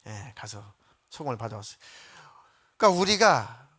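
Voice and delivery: usual speaking voice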